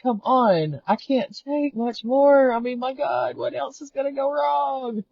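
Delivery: high, slow, pleading wail of despair; retold meltdown. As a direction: whine it out like everything is going wrong at once. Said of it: "I had this little meltdown".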